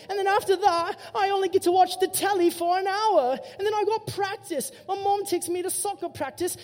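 put-on high, complaining British kid voice; whiny British accent.

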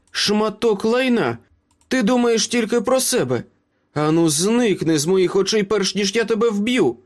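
high-pitched, angry tirade; furious threat. A narrator's reading. Meryl threatens Ace after kicking him, furious. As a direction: drive him off with real menace.

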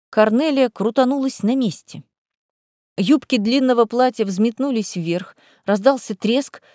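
usual speaking voice

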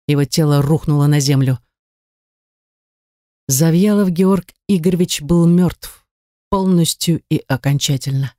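plain reading voice

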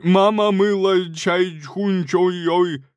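loud, high, slow fake-Chinese chant. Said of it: "Громче".

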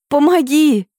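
high, loud plea; shouting for help. A narrator's reading. Heroine calling to Dorian for help, shouting. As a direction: cry out urgently in a high voice. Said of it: "кричу какому-то Дориану".

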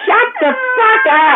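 put-on high, slow, yelled at the kid; furious yell. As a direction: bellow it at the child, drawn out. Mother yelling at child.

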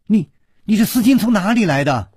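high, loud demand; startled princess crying out in outrage; sharp angry shout. A narrator's reading. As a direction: shout it as a furious challenge. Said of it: "大喝道".

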